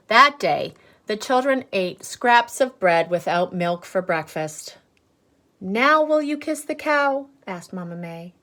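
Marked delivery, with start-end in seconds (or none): 5.6-7.3 insistent mother. Acted. Mother asking again.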